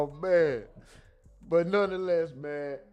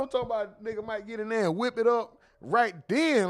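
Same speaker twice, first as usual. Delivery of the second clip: usual speaking voice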